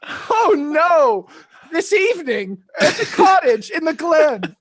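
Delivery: loud, high, slow gushing; hammed-up princess blurting it out, laughter creeping in; eager Aurora. Aurora inviting Philip to the cottage, loud mock gushing. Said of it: "And she goes".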